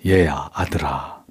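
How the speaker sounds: slow, low, affectionate call; tender. Acted. Father calling his elder son, tender. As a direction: draw it out low and lovingly. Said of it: "다정한 표현이 여기서 나왔습니다".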